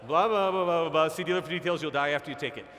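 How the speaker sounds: rapid-fire disclaimer. Retold or imitated. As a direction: imitate the fast fine print ending a commercial. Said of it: "hear something really fast? The end of a commercial".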